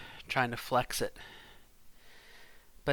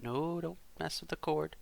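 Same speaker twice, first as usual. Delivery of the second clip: raised pitch, softened voice; gentle parental warning; 5 semitones higher, 6 dB quieter than usual. Real, not acted. Parent to son by the cord, gentle and high.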